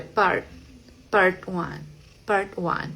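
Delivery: "part" with an almost inaudible T; very soft held T. Shown as a demonstration. Teacher showing the soft American T, held T. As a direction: stop "part" gently, unreleased, before "one". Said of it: "es muy, muy suave".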